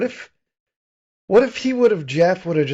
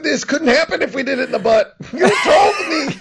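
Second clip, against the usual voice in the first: laughing through the words, higher; giggling as it spills out; 7 semitones higher than usual. Real, not acted. Cohost cracking herself up with the joke, laughing.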